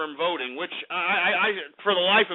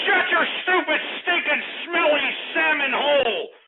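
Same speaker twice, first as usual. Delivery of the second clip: furious insult; 7 semitones higher than usual. Acted. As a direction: spit the insults out in a high rage. Host insults caller furiously.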